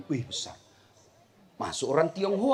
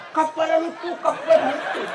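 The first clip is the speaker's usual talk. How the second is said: higher laughing Bugis shout of kapal lalu tuh; excited Bugis kids' call; 8 semitones higher than usual. Retold or imitated. Village kids in Bugis shouting at a passing plane. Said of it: "Bahasa Bugis".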